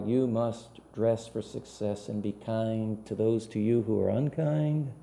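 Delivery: usual speaking voice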